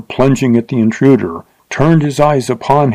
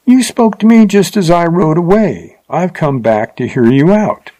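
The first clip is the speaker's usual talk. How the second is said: mocking tone; 7 semitones higher than usual. A narrator's reading. Rider confronts Wild Bill after checking his horse, mockingly. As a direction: taunt him in a raised, mocking voice. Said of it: "he said in a mocking tone".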